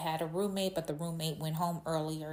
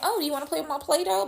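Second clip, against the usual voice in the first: put-on much higher, louder and fast, an eager little kid; child self asking; 10 semitones higher, 6 dB louder, faster than usual. Toddler asking the roommate to play, high eager kid voice. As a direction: rush it out like a three-year-old offering toys. Her three-year-old self asks roommate.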